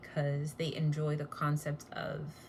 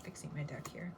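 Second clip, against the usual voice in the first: quiet unvoiced aside; whispering; 9 dB quieter than usual. Real, not acted. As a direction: whisper to yourself while busy. Muttering while shuffling cards.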